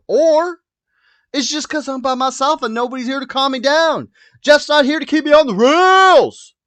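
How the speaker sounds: high, loud hammed-up shouting; yelly. Host alone with nobody to calm them, high comic yelling. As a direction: yell it way up high, letting it run wild. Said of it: "why I'm a little bit yelly".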